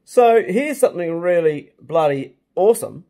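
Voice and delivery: loud, drawn-out hype; excited. Real, not acted. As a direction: sell it as something awesome. Excited introduction of an amazing talent.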